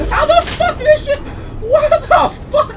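can't believe what just happened; high baffled outburst; disbelief. Real, not acted. Baffled at explosion.

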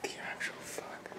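whispered in fear; terrified whisper; scared whisper. Real, not acted. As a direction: breathe it so nothing hears. Frightened downstairs at night.

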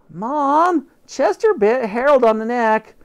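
high, loud tattling call; high kid calling. Acted. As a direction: yell to Mom like a kid telling on the cat. Boy calls out to mother.

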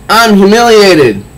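lightly voiced wounded villain; a hint of wounded pride; humiliated Riddler. Riddler confessing defeat to the Joker, lightly voiced. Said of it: "the Riddler gets up and says".

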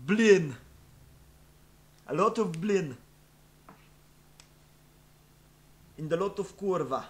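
hammed-up stupid Russian accent; thick mock Russian accent, played dumb; fake Russian accent. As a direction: do a silly, over-the-top Russian meme voice. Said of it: "speaking with a stupid Russian accent".

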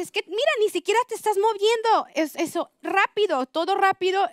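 a doctor cheering on her patient, excited; imitating excited doctor. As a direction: play the doctor gushing with delight. Doctor praising her during abortion.

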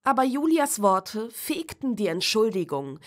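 ordinary delivery, nothing marked

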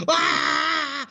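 long high-pitched wail; mock terrified scream. Storyteller reenacting the ride before five thousand kids, terrified scream. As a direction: scream as if scared for your life. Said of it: "I'm scared for my life".

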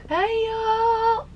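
high tearful parody of a cheery hello; mock vlogger greeting. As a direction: greet the viewers in a teary squeak. Mock vlogger greeting while crying.

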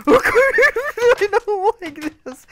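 high, loud panicky laughing squeal; panicked. Real, not acted. Player runs into danger in game, high loud panic.

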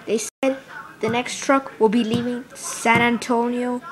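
ordinary delivery

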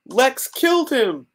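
very high, drawn-out Lois voice; hammed-up high cry of blame; mock distraught accusation. Lois blaming Lex to Supergirl, hammed-up distress.

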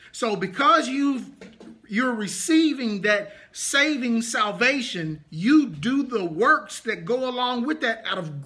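insistent teaching sermon with punched key words; emphatic preaching. Real, not acted. Preacher explaining belief and obedience to the congregation, emphatic preaching. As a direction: drive the point home to the congregation. Preaches on the verse just read.

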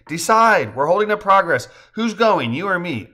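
high, loud, pressing demand; bossy, playful impatience; impatient urging. A narrator's reading. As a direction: hurry them along. Hurrying wife to go first.

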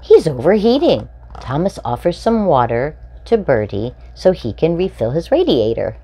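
bright, lively storybook read-aloud; animated children's story reading. A narrator's reading. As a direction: read it like a picture book for little ones. Reading picture book to children.

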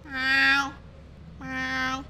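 drawn-out meowing; imitation cat meow. Player tricking friends with imitation cat meows. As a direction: meow like a cat to fool them.

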